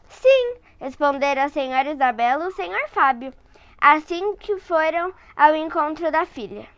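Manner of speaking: put-on lively read-aloud voice for a child; storyteller voice for a little one; story narration. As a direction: read it like a bedtime picture book. Reading picture book to child.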